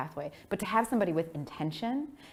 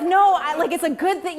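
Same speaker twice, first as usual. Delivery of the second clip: eager explaining; 10 semitones higher, 7 dB louder than usual. Retold or imitated. Explaining to parents.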